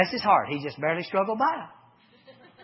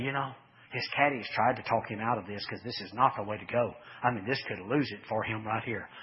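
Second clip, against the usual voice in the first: imitating quiet golf commentary, lower voice; hushed announcer; 8 semitones lower, 5 dB quieter than usual. Preacher imitating announcers doubting Phil, low and hushed. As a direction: murmur it like a commentator by the green. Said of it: "they talk real low about Phil".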